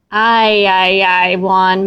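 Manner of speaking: a mother despairing at her son; dismayed cry. A narrator's reading. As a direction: sigh it out in dismay. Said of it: "Mama cried".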